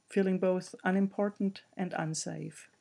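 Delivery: ordinary delivery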